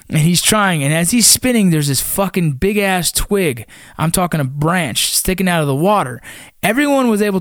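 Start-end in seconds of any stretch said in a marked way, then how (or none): none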